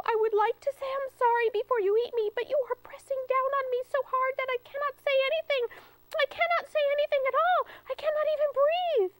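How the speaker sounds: very high, fast squeaky begging; breathless pleading squirrel. Acted. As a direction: rush the plea as if squashed.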